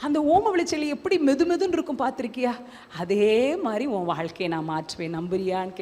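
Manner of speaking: low, hushed, intimate assurance; soft retold promise. Jesus promising the preacher a changed life, hushed. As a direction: imitate an inner voice promising, very softly.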